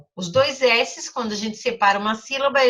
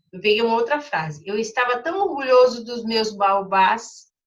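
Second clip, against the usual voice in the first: ordinary talk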